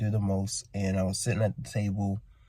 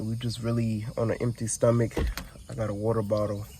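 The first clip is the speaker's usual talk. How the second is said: normal talking voice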